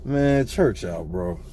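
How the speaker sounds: low, slow and quiet dismissal. Retold or imitated. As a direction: drag it out softly, like closing the matter. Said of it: "I said".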